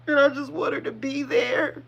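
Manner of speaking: choked up, high-pitched voice; fighting back real tears while remembering a loved one; tearful. Real, not acted. Tearful memory of her auntie.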